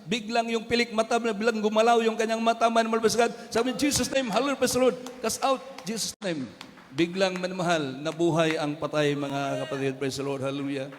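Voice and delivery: loud, rising revival testimony; exultant preacher crying praise; full-force preaching. Acted. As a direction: drive the miracle home to the crowd.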